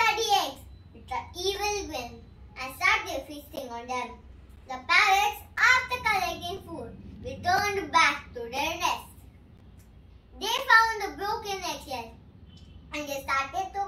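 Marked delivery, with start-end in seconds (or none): none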